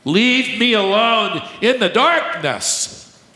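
high, slow, pleading voice; drawn-out cry to be left alone; mock plea of a stubborn unbeliever.

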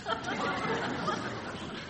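a high airy burst of laughter; real breathy laugh. Real, not acted. Laughing at the cashier's scheme, a high airy laugh. Speaker laughs at his own story.

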